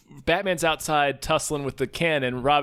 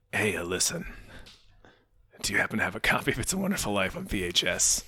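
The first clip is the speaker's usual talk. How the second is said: low, soft, halting voice; Batman; 7 semitones lower, 5 dB quieter than usual. Acted. Asking to borrow a Christmas tape, low and quiet. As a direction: quietly ask a favor in a low superhero voice. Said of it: "Batman being like".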